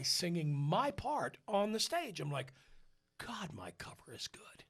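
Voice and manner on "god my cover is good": hushed, very soft aside to yourself; awed whisper. Retold or imitated. Singer watches his cover on stage, whispering in awe. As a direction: whisper it, impressed.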